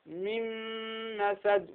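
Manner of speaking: "من مسد" as "mimm-masad" with sustained nasal hum; Verschmelzung mit Ghunna. Shown as a demonstration. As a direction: hold the doubled م in "من مسد" through the nose. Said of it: "ولا بد أن نظهر ماذا؟ الغنة".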